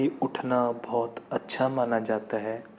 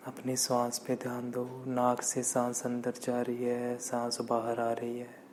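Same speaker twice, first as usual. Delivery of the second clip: guided meditation; 7 dB quieter than usual. Acted. Meditation teacher leading listeners to watch the breath, calm guided meditation. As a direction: guide breathing calmly, letting phrases stretch out.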